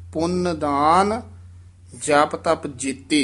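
ਪੁੰਨ ਦਾਨ rising, a break, then the rest; pause demo. Shown as a demonstration. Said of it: "ਇੱਥੇ ਵਿਸਰਾਮ ਲਾਉਣਾ ਹੈ".

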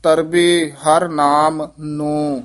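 slow, stretched ਮਨ ਧਰ ਤਰਬੇ ਹਰ ਨਾਮ ਨੋ; drawn out. Shown as a demonstration. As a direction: draw out every syllable slowly. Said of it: "ਲਮਕਾ ਕੇ ਬੋਲਣਾ ਹੈ".

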